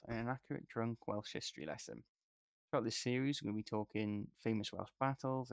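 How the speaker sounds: announcer-style intro; podcast intro read. Acted. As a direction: read the show opener.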